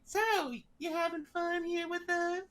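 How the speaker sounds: high-pitched cartoonish harlequin voice; Harley Quinn knockoff. Harlequin scare actor toying with bound visitor, high mock character voice. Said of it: "Harley Quinn knockoff character".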